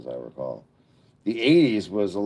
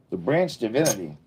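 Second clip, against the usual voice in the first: usual speaking voice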